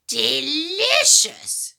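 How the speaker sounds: high, drawn-out, savouring delicious; slow relish. Acted. Grandma savouring the thought of slugs, slow gloat. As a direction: stretch it like a greedy witch.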